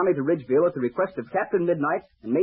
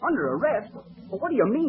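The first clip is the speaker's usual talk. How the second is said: startled, higher-pitched protest; indignant surprise; 6 semitones higher than usual. Acted. Young pilot to the official arresting him, indignant surprise. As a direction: blurt it out in shocked disbelief.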